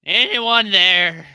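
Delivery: slow, drawn-out hungover call. Acted. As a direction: call out like you're nursing a hangover. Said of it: "in a hu-, ha-, very hungover voice".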